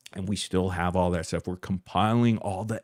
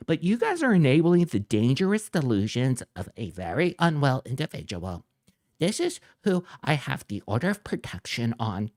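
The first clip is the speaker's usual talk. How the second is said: higher, whiny mocking voice; mock reading; 5 semitones higher than usual. Reading out the rival's post to viewers in a higher mocking voice. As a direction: sneer his words back in a higher voice. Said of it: "reading the rival's post".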